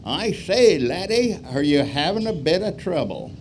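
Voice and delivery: put-on high, loud plummy British accent; hammed-up posh English gent in his MG with pipe; English country gent asking the crashed Yank, British accent; old British duffer. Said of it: "an old duffer".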